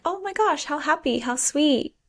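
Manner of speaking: high, squealing gush over the couple; gushing delight. Retold or imitated. Fan gushing to her cohost about the happy couple, high and excited. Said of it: "And you're like".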